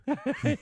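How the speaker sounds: high sudden laugh; burst of laughter. Real, not acted. Laughs at roto-rooter joke.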